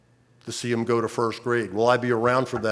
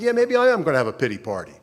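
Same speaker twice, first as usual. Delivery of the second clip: quick, defiant sarcastic comeback; sarcastic echo; 3 semitones higher, faster than usual. Retold or imitated. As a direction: throw her words back at her. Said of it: "I said".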